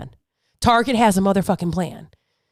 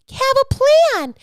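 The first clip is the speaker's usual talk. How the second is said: high-pitched mock preachy holler; over-the-top sing-out preaching. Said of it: "I can't sit here and preach".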